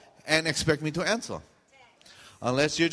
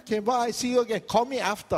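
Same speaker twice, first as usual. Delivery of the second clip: higher, cheery fake goodbye; insincere friendliness; 7 semitones higher than usual. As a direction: sign off too sweetly, not meaning it. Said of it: "And you don't mean it".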